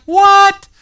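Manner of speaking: high, loud startled yelp; a sudden shocked cry of what. Retold or imitated. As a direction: jump out of your skin at it. Mock startled waking man.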